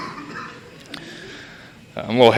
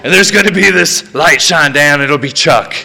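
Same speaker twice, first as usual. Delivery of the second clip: mock grand vision; 6 semitones higher than usual. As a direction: pitch it up like a man sure the skies will open. Acted grand fantasy before the swim.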